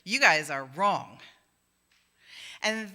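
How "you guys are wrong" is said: slow, louder, stern pronouncement; God rebuking the friends; booming verdict. Retold or imitated. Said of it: "God comes down and says".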